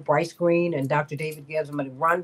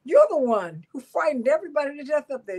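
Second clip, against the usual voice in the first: playful teasing; 7 semitones higher than usual. Retold or imitated. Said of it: "I said".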